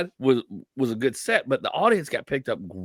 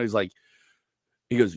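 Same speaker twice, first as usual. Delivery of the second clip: normal talking voice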